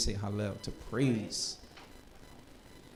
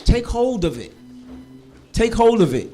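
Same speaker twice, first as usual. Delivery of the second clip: louder, higher urging to the class; rallying call; 6 semitones higher, 6 dB louder than usual. Real, not acted. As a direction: fire them up to own the language.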